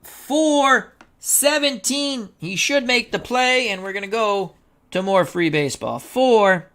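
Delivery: high, suspenseful announcer's call; tense play-by-play. Pretend. Announcer calls a possible game-winning fly ball, tense high play-by-play. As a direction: hold it high, drawing out the key calls.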